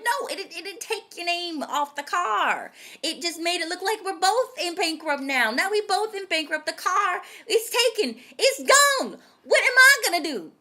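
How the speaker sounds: high exasperated argument building to a loud outburst; distraught protest. Retold or imitated. Retold upset argument with co-signer.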